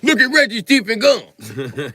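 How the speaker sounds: high, loud, stretched-out jeer; mock mocking shout. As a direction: jeer it slowly in a high put-on voice. Quoting comedian in put-on voice.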